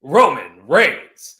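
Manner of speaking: grand, deliberate award-show announcer. Acted. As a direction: read the nominees slowly, each name drawn out for the crowd. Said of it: "let's get into my announcer voice".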